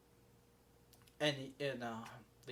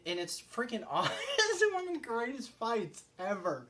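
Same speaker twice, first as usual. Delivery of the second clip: laughing through high-pitched praise; 8 semitones higher, 4 dB louder than usual. Real, not acted. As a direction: gush about it while breaking into laughter. Delighted laugh over favorite fight.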